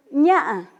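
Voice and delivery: "ña" más fuerte, tono alto; tono de "ven". Shown as a demonstration. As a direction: di "ña" alto para decir ven. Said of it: "yo estoy diciendo ven". ("ña" con tono de ven)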